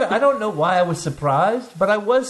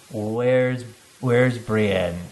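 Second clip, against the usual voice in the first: Tormund's low voice; 7 semitones lower, much slower than usual. Retold or imitated. Tormund asking Jon where Brienne is, low and lightly mocked. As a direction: drop low and call for her like a lovesick brute. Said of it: "he's like".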